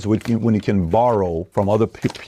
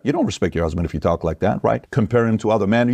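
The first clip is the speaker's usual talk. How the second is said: ordinary delivery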